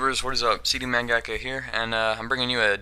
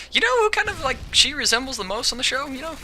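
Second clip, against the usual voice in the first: friend's question. Retold or imitated. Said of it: "he's just like".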